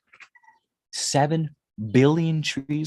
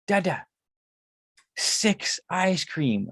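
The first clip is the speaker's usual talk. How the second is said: put-on slow, higher little kid bargaining; toddler son asking; 4 semitones higher, much slower than usual. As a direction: drag it out like a three-year-old asking big. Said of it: "And he goes".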